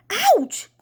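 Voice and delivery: high, loud ouch; sudden high-pitched pained yelp. Acted. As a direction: cry out as if pecked. Dad is pecked by the crow.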